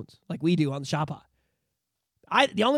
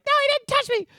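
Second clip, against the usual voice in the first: put-on scared kid voice; 4 dB louder than usual. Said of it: "I'm like".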